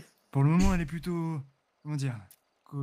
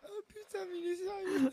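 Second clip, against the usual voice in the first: laughing disbelief; 7 dB quieter than usual. Real, not acted. Reacting in disbelief.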